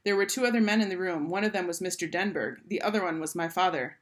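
ordinary delivery

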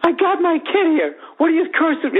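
high, indignant mock dad voice; irate dad. An angry dad complains about cursing, in a high indignant mock voice. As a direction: whine it like an offended father. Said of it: "and he's like".

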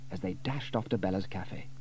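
usual speaking voice

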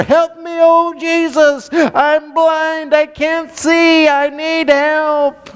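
high, pleading yell, slowing at the end; yelling for help. Retold or imitated. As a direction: cry out desperately, drawing out the last words. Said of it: "Then he yelled all the more".

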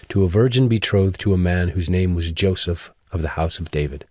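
ordinary delivery

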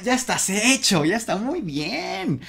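high, singsong burst of praise; playful cheering. Pretend. Teacher telling student it's already done, playful high cheer.

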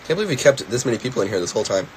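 ordinary talk, nothing marked

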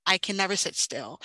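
ordinary delivery

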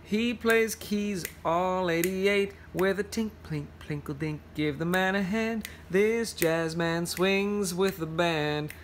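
slow, sing-song picture-book verse; reading to children. Acted. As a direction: lean into the rhythm of the rhyme. Reads picture book aloud.

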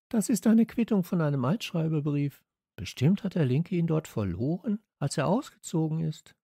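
high-pitched character voice; Steffi explaining. A narrator's reading. Girl explains the receipt to friends, high-voiced.

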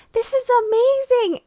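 high, loud, drawn-out delight; excited shout. Real, not acted. Player shouting into an echoing cave with delight. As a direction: relish the echo.

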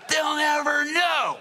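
high, loud and slow; smug little fish, sure it's safe; gloating fish. Acted. Fish gloating at the fishermen above, loud and high. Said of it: "there are fish under there going".

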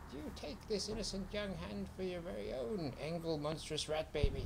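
officiate like a sly weasel; sly hissing weasel. A narrator's reading. Weasel priest conducting the wedding.

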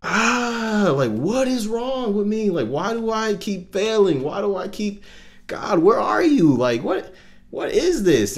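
re-enacted high-pitched cry to God, pleading; retold desperate scream. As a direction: wail it out in frustration, as you screamed it then. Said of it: "I just literally screamed at the top of my lungs".

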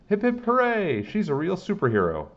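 high excited shout; cheering kids. A narrator's reading. Kids shout praise for the rescuer, high and excited. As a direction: chant it like a cheering crowd. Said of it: "Kids were shouting".

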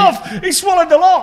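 high, loud, gleeful exclamation; triumphant punchline. Real, not acted. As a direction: crow about getting away with it. Gleeful punchline of his tale.

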